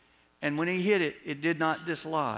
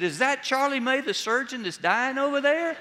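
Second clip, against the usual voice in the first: high, loud, half-scandalised question; nosy diners pointing him out; gossiping onlookers; 11 semitones higher, 6 dB louder than usual. Retold or imitated. Onlookers gossiping about dying surgeon.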